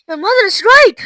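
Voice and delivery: high, loud agreement; alarmed shout. A narrator's reading. Mister Beauregard backing up Violet's mother, shouting. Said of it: "shouted Mister Beauregard".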